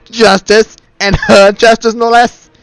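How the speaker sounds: loud, sneering disbelief; scornful mockery. Villain mocking the knight's hope, loud and scornful. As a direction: ridicule the very idea. Villain mocks her justice.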